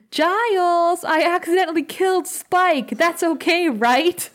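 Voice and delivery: high, sing-out mock confession; mock innocent call. Buffy telling Giles she killed Spike, mock innocent call.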